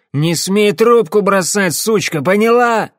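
high, loud, slow furious bark; barked threat. Acted. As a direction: snarl it at her, drunk and enraged. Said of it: "рявкнул".

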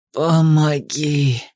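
very slow, low croaked plea; madman's croak. A narrator's reading. Madman repeating his plea for help, drawn-out croak. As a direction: stretch the plea out, low and hoarse. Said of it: "повторил безумец".

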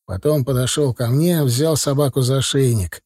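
ordinary delivery

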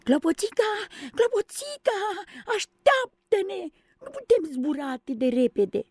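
very high, tearful pleading cry; begging her not to leave them; frightened calling. Acted. The children calling after Tinkerbell, scared of being left behind.

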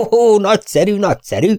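high, swooping cry of delight; delighted exclamation. Acted. Eager lord greeting good news, delighted exclamation. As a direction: exclaim with rising enthusiasm.

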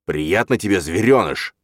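growled taunt. A narrator's reading. Father choking the boy he calls little beast, growling. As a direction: bear down harder on the insult. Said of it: "рыкнул отец".

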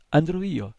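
put-on high, eager little girl's cry; eager exclamation. A narrator's reading. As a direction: shout it out like an eager child. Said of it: "esclamò una bambina". (esclamazione acuta e impaziente di bambina)

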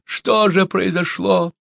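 high, slow lament; stretched, high cry of bewilderment; sobbing plea. A narrator's reading. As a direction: ask in anguish, drawing it out. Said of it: "рыдал Чумбока".

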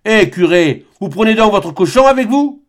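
loud, high, mocking country uncle calling out; jovial peasant hail. A narrator's reading. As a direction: call across the road to the nervous priest. Jean Bernat raille le frère. (l'oncle interpelle le curé, fort et goguenard)

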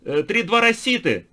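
high, loud, slow madman chant; wild unhinged ranting. A narrator's reading. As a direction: rant it like a raving lunatic.

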